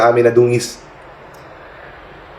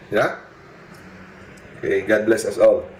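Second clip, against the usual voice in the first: ordinary talk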